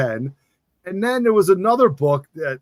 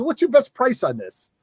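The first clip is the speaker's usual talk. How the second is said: higher-pitched New York haggler; imitated New York accent, pitched up; Jersey New York accent; 8 semitones higher than usual. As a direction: ask like a Jersey kid dealmaker. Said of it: "says it with such a better accent".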